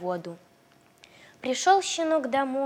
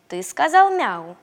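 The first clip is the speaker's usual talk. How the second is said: stern; 4 semitones higher, 8 dB louder than usual. Acted. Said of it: "строго спросил его щенок".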